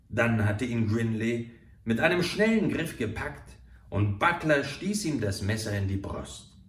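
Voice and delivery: usual speaking voice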